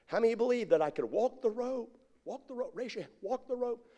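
lightly voiced showman, high and soft; showman polling crowd. Rope walker to watching crowd, high light appeal. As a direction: imitate a performer asking onlookers.

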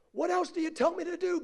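high wailing plea; acted holler from far away; desperate calling. Man on cliff asking God, high desperate call.

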